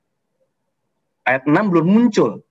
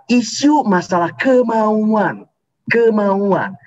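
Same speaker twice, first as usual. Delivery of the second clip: "isu masalah kemauan, kemauan" stretched out slowly, each word weighted, higher; slow stressed repeat; 5 semitones higher, much slower than usual. Shown as a demonstration. Preacher repeating his key point for online listeners, slow and emphatic. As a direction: hammer home "kemauan" a second time. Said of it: "Saya ulangi".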